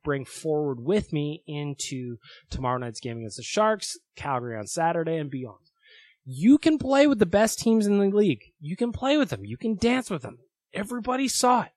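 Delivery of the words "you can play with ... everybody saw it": higher, louder, fired-up rally; excited pep talk. Real, not acted. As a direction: pump the team up.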